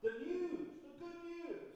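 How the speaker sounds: high, soft imitation of a street cry; mock newsboy shout. Newsies out shouting around, mock high-pitched cry. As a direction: call it out like a distant paperboy. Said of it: "they're out shouting around".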